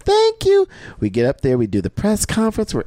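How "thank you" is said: very high, loud falsetto thanks; squealed thank you. Retold or imitated. Retelling thanking a fan, high falsetto squeal.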